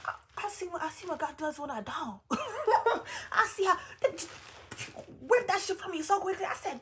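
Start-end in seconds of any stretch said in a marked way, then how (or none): 2.3-6.5 high laugh that spills into the words. Real, not acted. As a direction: laugh through it, pitch up high. Laughing about God's lesson.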